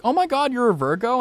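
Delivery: high, gushing Californian astonishment; mock a Californian gasping over star signs.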